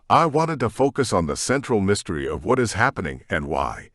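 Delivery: usual speaking voice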